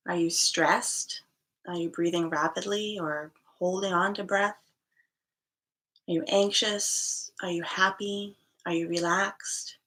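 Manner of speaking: guided meditation. Acted. Yoga teacher guiding online students to the breath, calm meditation voice. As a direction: ease them unhurriedly into noticing the breath.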